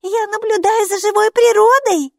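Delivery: put-on high, loud girl's call. A narrator's reading. Girl calling up to her father on the balcony. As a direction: shout up to dad.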